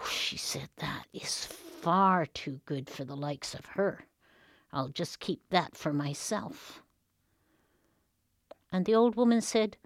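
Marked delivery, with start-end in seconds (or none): 0.8-6.8 slow whispered scheme, keeping the bread; greedy whisper. Acted. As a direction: hush it to yourself, plotting. Girl scheming to keep bread.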